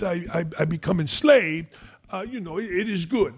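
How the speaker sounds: stammering French accent, playing the victim; Tony Parker French accent. Host mocking Parker's China trip in a French accent. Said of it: "I kinda like the accent".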